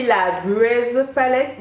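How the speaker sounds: slow, careful English names in a French accent; self-conscious French accent on English words. Real, not acted. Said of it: "vous excuserez mon accent".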